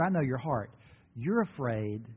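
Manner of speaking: ordinary talk, nothing marked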